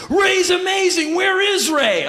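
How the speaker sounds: high, slow, shouted enthusiasm. Retold or imitated. Rock singer eager to meet the artist, high shouted enthusiasm.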